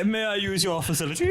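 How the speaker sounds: laughing, overly formal question; mock posh politeness. Friend mocks stoned manners, laughing posh request. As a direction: ask grandly while laughing. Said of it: "you would say".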